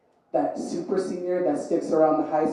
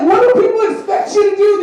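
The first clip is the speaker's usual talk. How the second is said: much higher, louder shout; shouting in comic exasperation; exasperated shout; 14 semitones higher, 7 dB louder than usual. Real, not acted. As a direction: yell it in disbelief. Exasperated outburst at internet tough guys.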